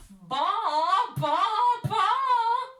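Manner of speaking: very loud high baa; loud bleating. Acted. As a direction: bleat it sing-song. Hen bleats.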